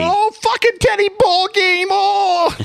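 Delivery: high, excited cry of recognition; excited shout. Real, not acted. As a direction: squeal the name out, thrilled. Excited callout of the character.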